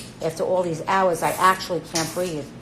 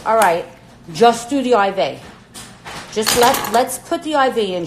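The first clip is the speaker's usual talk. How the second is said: bossy nurse orders; 4 semitones higher, 7 dB louder than usual. Retold or imitated. Nurse taking charge, calling orders.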